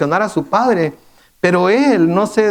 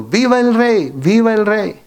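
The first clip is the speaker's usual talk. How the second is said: a touch of a raised hail for the new king; mild read-out cheer; 4 semitones higher than usual. A narrator's reading. Husai greets Absalón, cheering him as king, in a mild raised hail. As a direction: lift the voice a little as if hailing the king. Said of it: "lo saludó gritando".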